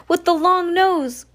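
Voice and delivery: high, loud cry; high alarmed shout; cried out. A narrator's reading. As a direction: call it out urgently. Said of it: "Klaus cried".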